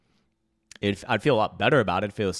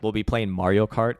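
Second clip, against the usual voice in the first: ordinary talk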